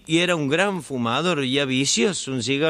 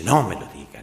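voice from inside; 6 semitones lower than usual. Acted. Hidden man hearing more confession, pained protest. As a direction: cry out, then sink back. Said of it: "de nuevo la voz".

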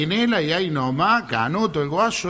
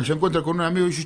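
ordinary delivery

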